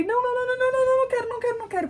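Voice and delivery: high rapid no no no; panicked refusal. Retold or imitated. Mother panicking as she touches the baby, high repeated no. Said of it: "E eu falei".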